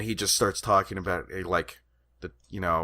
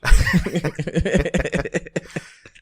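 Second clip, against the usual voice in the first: high, loud burst of laughter; real laugh, loud and high; 11 semitones higher, 10 dB louder than usual. Real, not acted. Friend laughing loudly at the mumbling-actor bit. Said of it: "laughs at the bit".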